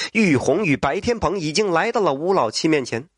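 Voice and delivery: usual speaking voice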